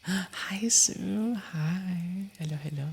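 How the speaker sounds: bright, high sing-song hello; high-pitched greeting. Real, not acted. Streamer greeting viewer Sue mid-story, high-pitched hellos. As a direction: pop the voice up to greet a friend.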